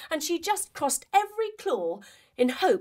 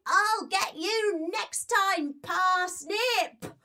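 high, slow, sore-loser mouse; a cheeky mouse vows revenge; mouse calling out; 7 semitones higher, much slower than usual. Acted.

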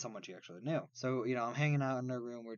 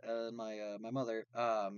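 ordinary talk, nothing marked

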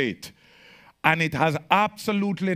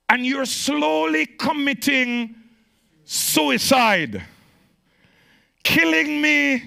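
high, loud, driving preacher voice; fiery preaching; 8 semitones higher, 6 dB louder than usual. Acted. Pastor warning the church about energy drinks, fiery preaching. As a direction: preach it hard to the congregation.

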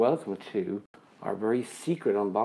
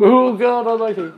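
much higher, louder burst; delighted opening a present; 13 semitones higher, 12 dB louder than usual. Real, not acted. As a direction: blurt it out in amazed glee. Reaction to Mark's hello.

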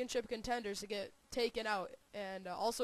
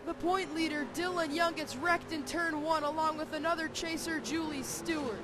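excited; 6 semitones higher, 5 dB louder than usual. Real, not acted. Big wreck called live.

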